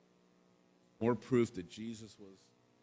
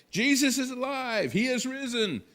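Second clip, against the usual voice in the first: joyful proclaiming; 8 dB louder than usual. Pretend. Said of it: "running, sharing with people".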